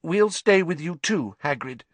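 high-pitched, emotional offer; fighting back tears. A narrator's reading. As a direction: promise it while holding back tears. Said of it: "fighting back tears".

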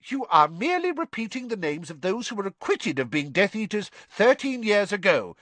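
high, rising angry minister; angry Fudge. A narrator's reading. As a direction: snap back, angry and high. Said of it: "said Fudge angrily".

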